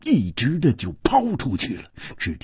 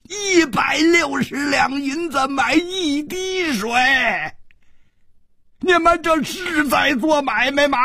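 high-pitched outraged shout; 14 semitones higher than usual. A narrator's reading. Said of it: "大声地嘶喊起来".

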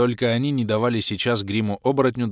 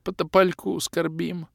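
slow, high mournful sigh; 9 semitones higher, slower than usual. Acted. Orbit mourning the ghost, sighing. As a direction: sigh it out in grief. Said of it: "вздохнул Орбит".

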